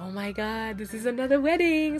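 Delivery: high, loud, incredulous gush; a loud high groan of disbelief at yet another wedding; exasperated excitement. Retold or imitated. Fan groans about another wedding, high and loud. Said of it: "It was like".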